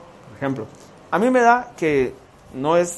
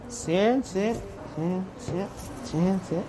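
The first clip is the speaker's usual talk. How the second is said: counting under his breath, much softer; sly quiet counting; 7 dB quieter, much slower than usual. Retold or imitated. Pastor secretly counting the offering, softly under his breath. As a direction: slip each bill away while counting softly.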